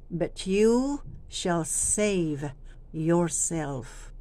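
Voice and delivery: very slow, falling on yourself; slow down at the end; slow final inflection. Shown as a demonstration. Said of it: "Gumamit tayo ng inflection dito".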